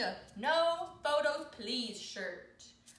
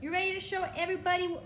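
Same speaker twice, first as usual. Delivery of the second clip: faster, louder and higher hype; excited announcer; 5 semitones higher, 5 dB louder, faster than usual. Real, not acted. Owner hyping her dog's fashion show, fast excited announcing.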